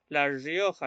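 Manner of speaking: "Rioja" with riojano R buzzed like zh; riojano accent. Shown as a demonstration. Teacher repeating the example, riojano R in "Rioja". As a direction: lean on the riojano buzzing R of "Rioja". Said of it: "los riojanos cuando hablan tienen una vibración".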